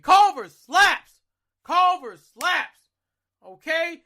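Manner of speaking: really selling how good it is; excited hype. Real, not acted. Raving to viewers about Culver's, loud excited hype. Hyped food praise.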